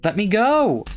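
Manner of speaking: high, loud frantic yell; desperate scream. Acted. Nina pinned by Yaya, screaming to be let go. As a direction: scream to break free.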